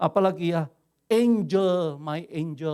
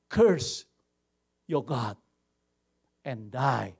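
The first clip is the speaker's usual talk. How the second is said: bitter wife's words; 5 semitones lower, much slower than usual. Retold or imitated. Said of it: "Ini yang nggak enak".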